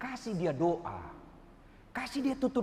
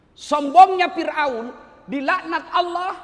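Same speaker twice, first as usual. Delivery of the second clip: slow, loud, higher condemnation of Pharaoh; preaching shout; 6 semitones higher, 11 dB louder, slower than usual. Real, not acted. Preacher condemns Pharaoh to the crowd, slow loud shout. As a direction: thunder the verdict slowly.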